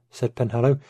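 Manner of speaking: plain reading voice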